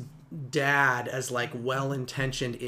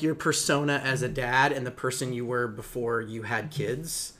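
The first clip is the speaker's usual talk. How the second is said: normal talking voice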